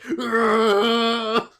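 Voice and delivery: long, high, loud "argh"; hammed-up wail of a plummeting character; mock scream. Joking about a funnier way to crash, mock scream. Acting out falling starmon's scream.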